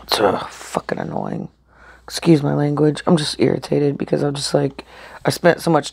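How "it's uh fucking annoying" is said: whispered curse. Real, not acted. Vlogger whispering a curse at her failed dinner. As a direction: swear it in a low whisper. Swears, then excuses her language.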